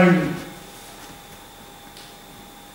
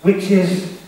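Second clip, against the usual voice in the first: ordinary talk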